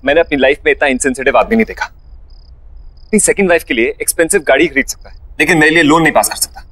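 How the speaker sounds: fast, heated complaint; angry rant. Acted. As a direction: vent angrily at top speed. Husband ranting about boss.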